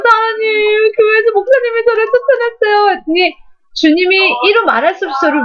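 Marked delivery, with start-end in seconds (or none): 0.0-2.9 high, pleading mock sob; half-acted tearful complaint to God; retold crying prayer. Woman telling God the pastor threw her out, high and pleading. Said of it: "막 울면서".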